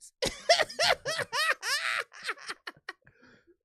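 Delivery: high, loud burst of laughter. Real, not acted. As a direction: crack up at the joke. Reacts to own story.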